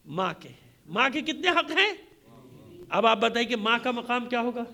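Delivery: ordinary talk, nothing marked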